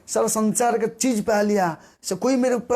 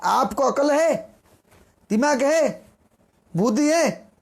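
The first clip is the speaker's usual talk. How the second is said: retold scolding; 5 semitones higher, slower than usual. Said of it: "पत्नी तो डांट सुनना शुरू कर दिया".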